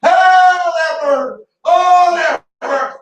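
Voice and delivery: high, loud yelp of pain, then thanks; mock pained scream. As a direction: ham up the burning finger. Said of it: "he went to scream".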